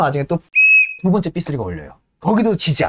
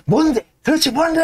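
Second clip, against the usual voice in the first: much higher, exasperated; irritated demand; 3 dB louder than usual. Retold or imitated. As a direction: snap at the interruption. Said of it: "하면서 이제 삽을 탁".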